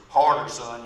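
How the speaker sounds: retold firm urging. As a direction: urge the son on with a sudden push of volume. Father urges son to hit harder.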